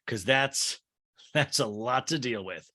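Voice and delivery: ordinary talk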